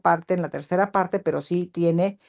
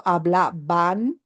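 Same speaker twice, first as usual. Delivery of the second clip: slow example word; much slower than usual. Shown as a demonstration.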